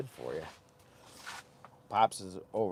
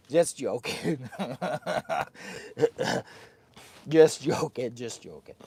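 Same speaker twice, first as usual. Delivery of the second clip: laughing; 7 dB louder than usual. Real, not acted. Laughing after fake threat.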